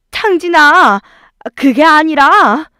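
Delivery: high, loud, drawn-out plea; flustered protest. Acted. Mother making excuses to her son, flustered protest. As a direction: cut in to explain yourself.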